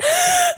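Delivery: sharp high gasp for breath; mock gasp for air. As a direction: gasp as if short of air in a hot tent. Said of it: "and you're like".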